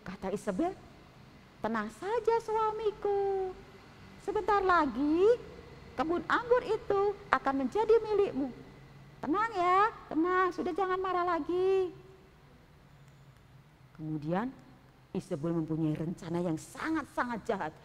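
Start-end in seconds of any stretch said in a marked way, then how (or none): 1.6-11.9 calm him down sweetly; soothing queen. Acted.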